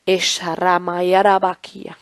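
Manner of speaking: low, soft flowing tongues; speaking in tongues. Real, not acted. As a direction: pray in tongues under your breath. Tongues at the close. (hablando en lenguas en voz baja)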